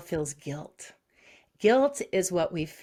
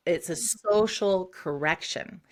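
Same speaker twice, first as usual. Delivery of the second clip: ordinary talk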